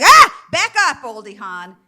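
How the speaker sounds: mock horror at your own face; startled recoil. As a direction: recoil from the screen. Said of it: "It's like".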